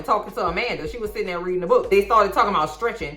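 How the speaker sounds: normal talking voice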